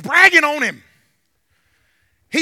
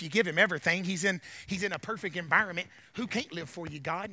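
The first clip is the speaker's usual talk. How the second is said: low, soft, needling challenge; Lucifer; 8 semitones lower, 7 dB quieter than usual. Retold or imitated. Lucifer challenging God over Job, low and needling. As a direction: argue quietly, low in the voice. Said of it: "I can hear Lucifer saying".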